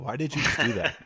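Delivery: high-pitched, incredulous questioning; baffled disbelief. Acted. Repeats his baffled question.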